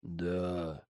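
flat, quiet hypnotized reply; emotionless. Acted. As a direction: answer like an empty shell. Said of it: "безэмоционально ответил тот".

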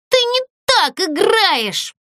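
high, slow, indignant cry; sudden shout. Acted. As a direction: yell at her from the seats. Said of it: "вдруг крикнула Злюня".

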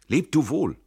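high, loud farewell cry; calling out. A narrator's reading. Fleeing Tränk bids his comrade farewell, calling out. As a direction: shout a hurried goodbye. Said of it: "ruft Tränk".

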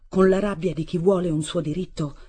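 ordinary delivery

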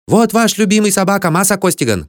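put-on high-pitched foreign boy calling out; excited shouting. A narrator's reading. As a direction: cry it out eagerly in a high voice. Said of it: "кричал он". (радостный крик тонким голосом)

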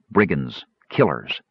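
ordinary delivery

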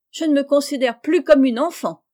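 voix haute et forte de jeune fille qui proteste; protestation vexée. A narrator's reading. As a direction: se défendre vivement d'être une enfant. Meg proteste contre sa mère. (protestation vive, plus haut et plus fort)

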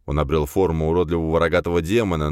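ordinary delivery

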